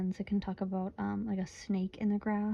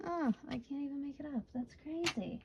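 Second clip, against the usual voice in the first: softer, higher, quick amazement; hushed disbelief; 5 semitones higher, 8 dB quieter than usual. Real, not acted. Reader to viewer, hushed and higher in shock. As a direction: gasp it out in quiet shock.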